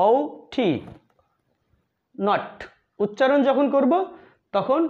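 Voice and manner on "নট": "নট" said with a short o; short vowel sound. Shown as a demonstration. Teacher contrasts "নট" with the long-vowel "নোট". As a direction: say "নট" quick and short. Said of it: "short সাউন্ডে নট".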